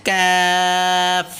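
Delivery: কাফ drawn out on a level tone; stretched vowel. Shown as a demonstration. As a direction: hold the "aa" of কাফ very long. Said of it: "এগুলো টানেন".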